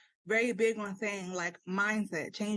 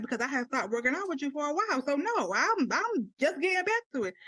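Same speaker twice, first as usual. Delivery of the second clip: defensive excuse; 7 semitones higher than usual. Retold or imitated.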